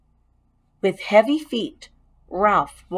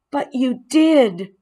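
slow, higher, pointed accusation; accusing; 6 semitones higher, 3 dB louder, slower than usual. Acted. Boy blaming the mouse, mildly accusing. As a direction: lay the blame, slowly. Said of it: "Keith's voice was accusing".

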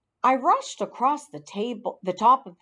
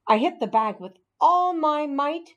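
plain reading voice

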